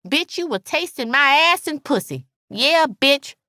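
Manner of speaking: high, defiant, vicious bragging; spiteful, triumphant friend; gloating Alice. A narrator's reading. Narrator voices gloating Alice.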